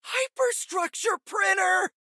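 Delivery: put-on a thrilled kid spotting the coolest gadget ever; excited shout. Spidey gawking at Tony's lab gear, excited shout.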